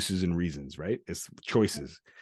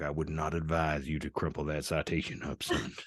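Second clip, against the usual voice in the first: low, soft cop imitation; a quiet warning, lightly voiced as a cop; 4 semitones lower, 6 dB quieter than usual. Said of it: "He's like".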